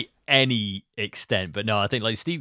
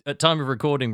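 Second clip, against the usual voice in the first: ordinary talk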